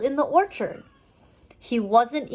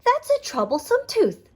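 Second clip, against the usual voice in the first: much higher quacky duck; 12 semitones higher than usual. Acted. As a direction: chirp it up like a duck. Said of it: "said Daphne the duck".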